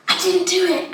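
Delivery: lightly voiced worried father; a touch of dad pleading. Child imitating dad repeating a mild plea. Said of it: "Like that".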